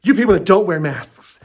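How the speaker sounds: ordinary talk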